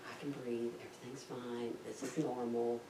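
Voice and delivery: soft, low, steadying murmur; calm self-talk. Retold or imitated. New diver trying to stay composed underwater, quiet calm. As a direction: talk yourself down under your breath. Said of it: "just trying to be calm".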